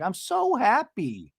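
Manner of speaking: high, drawn-out mock joy; mock overjoyed. Retelling a relieved friend's good news, mock overjoyed. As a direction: gush it slowly as a retold quote.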